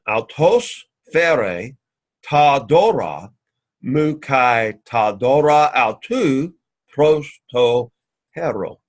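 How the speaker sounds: very slow, broken into chunks; drilling the Greek slowly, word group by word group; slow syllable-by-syllable repeat. Shown as a demonstration. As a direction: spell it out phrase by phrase for students. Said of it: "let's say it again".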